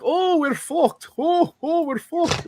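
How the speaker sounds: very high, drawn-out cartoon vampire accent; Count from Sesame Street. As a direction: wail it slowly like a puppet count, far above your own pitch. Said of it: "imagine, like, the Count from Sesame Street".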